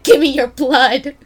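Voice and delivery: mock demand. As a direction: bark it like a draft officer. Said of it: "It's like".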